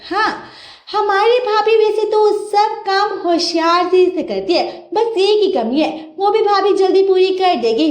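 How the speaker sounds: sister-in-law needling her brother's wife; teasing sister-in-law. Acted. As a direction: tease the new bride sweetly but slyly.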